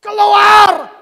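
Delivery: explosive high yell of command; re-enacted full-force exorcism shout. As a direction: shout it out to expel a spirit. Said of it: "Saya teriak seperti itu".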